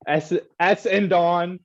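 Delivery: S and Don stretched slowly with a laugh; overpronounced, laughing. Shown as a demonstration. Laughing at the team name, S and Don slow and drawn out. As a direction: drag out S and Don, amused. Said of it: "extremely pronouncing the S".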